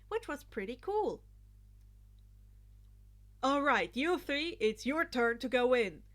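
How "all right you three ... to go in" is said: louder, formal command voice; announcing. Acted. Ida waving the group in, loud and formal. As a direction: announce it like a class rep. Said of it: "Ida announced".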